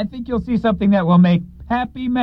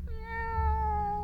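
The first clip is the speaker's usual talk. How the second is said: soft high kitten meow; 12 semitones higher, 11 dB quieter than usual. Acted. Kitten character meows.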